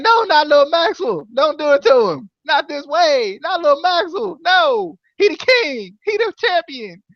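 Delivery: high-pitched, hammed-up distress; mock pleading. Host protesting Maxwell being Rosemary's baby, mock high pleading.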